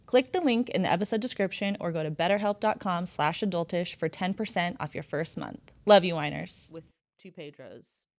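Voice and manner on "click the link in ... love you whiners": loud, upbeat sponsor read. Acted. Host reading the BetterHelp ad to listeners, loud and upbeat. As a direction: sell the sponsor with punchy energy.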